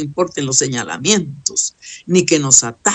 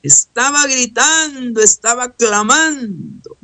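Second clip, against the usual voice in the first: clamouring; 7 semitones higher, slower than usual. Acted. Preacher enacts the clamour himself.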